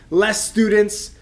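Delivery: normal talking voice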